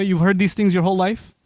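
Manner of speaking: ordinary talk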